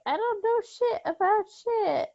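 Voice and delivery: lightly acted know-nothing, high-pitched; imagined people denying. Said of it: "still people would be like".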